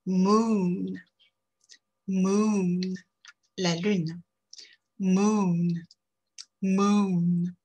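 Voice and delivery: Moon held long with rounded, projected lips; English long oo. Shown as a demonstration. Teacher drilling Moon for French learners with a long oo. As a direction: stretch the oo in Moon. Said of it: "On y va".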